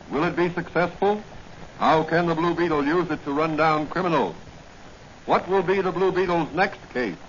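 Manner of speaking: ordinary talk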